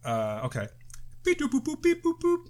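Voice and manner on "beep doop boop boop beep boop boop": high beep-boop dialing noises; phone beeps. Pretend. Mimicking phone dial tones.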